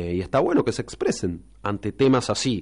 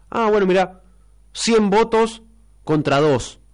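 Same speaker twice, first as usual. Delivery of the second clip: higher-pitched imitation of an official tally; hammed-up, higher voice reading out votes; mock vote announcement; 9 semitones higher than usual. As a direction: act out announcing the count, pitched well up. Mimics international bodies reading vote tally.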